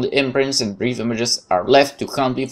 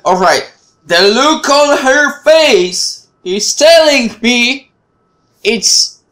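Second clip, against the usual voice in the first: much higher, louder and slow; startled outburst; 12 semitones higher, 8 dB louder, much slower than usual. Real, not acted. Seeing her face at the door, a slow, high, loud alarmed cry. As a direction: blurt it out in alarm. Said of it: "sudden outburst, reading trouble".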